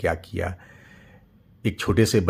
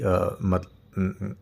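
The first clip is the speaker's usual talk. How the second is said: usual speaking voice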